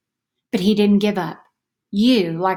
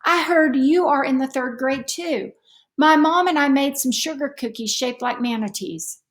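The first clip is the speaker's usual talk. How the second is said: put-on higher, friendly little-girl voice; young neighbor girl voiced higher; new girl; 6 semitones higher than usual. A narrator's reading. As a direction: introduce yourself sweetly to a new neighbor. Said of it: "A girl hidden deep inside a parka said".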